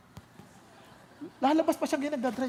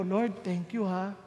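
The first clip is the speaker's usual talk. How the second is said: grateful prayer; 9 semitones lower, 4 dB quieter than usual. Retold or imitated.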